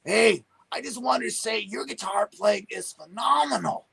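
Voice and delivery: high, strange put-on voice, imitating the drummer; Danny's funny voice. Drummer Danny praising the young guitarist, in a high odd imitated voice. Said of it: "this is how he sounded".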